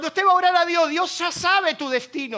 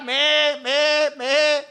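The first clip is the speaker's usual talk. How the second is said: sheep bleat; 4 dB louder, much slower than usual. Pretend. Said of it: "escuchar a las ovejas".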